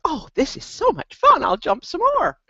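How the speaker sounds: high excited puppy voice; playful high puppy voice. Retold or imitated. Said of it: "And puppy goes".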